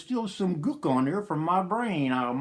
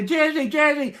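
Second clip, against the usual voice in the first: calling out to wife; 7 semitones higher than usual. Retold or imitated. Calls out to his wife.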